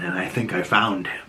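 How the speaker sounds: low, quiet, knowing villain line; lightly voiced Fisk savoring a discovery; menacing boss voice. Fisk names his new villain, low and quiet. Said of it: "Fisk says".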